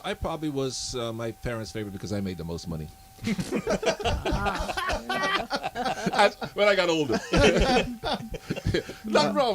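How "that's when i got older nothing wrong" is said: high laughter around slow words; talking through a big laugh. Real, not acted. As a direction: crack up at your own joke. Cracks up at own joke.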